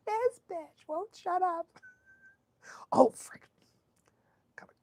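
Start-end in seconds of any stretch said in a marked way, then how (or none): 0.1-1.7 mock friend. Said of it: "She's like".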